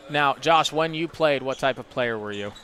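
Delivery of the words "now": ordinary talk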